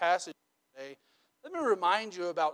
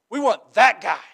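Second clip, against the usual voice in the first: people pointing; 2 semitones higher, 9 dB louder than usual. Retold or imitated. Mock dialogue, people choosing king.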